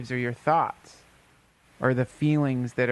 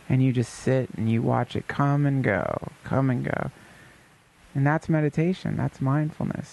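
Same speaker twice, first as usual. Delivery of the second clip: usual speaking voice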